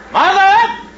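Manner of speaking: very high rising yell; calling out. Acted. Nero yells again for his mother, rising high. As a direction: shout her name as loud and high as you can.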